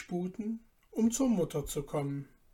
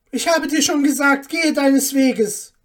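high, loud angry dismissal; wrathful; 8 semitones higher, 13 dB louder than usual. A narrator's reading. Mother ordering the dwarf away, wrathful. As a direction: send them packing in fury. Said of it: "entgegnete Frau Hanne zürnend".

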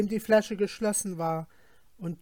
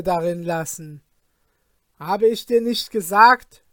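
stern fisherman; 3 semitones higher, 8 dB louder than usual. Acted.

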